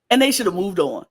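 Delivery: ordinary talk, nothing marked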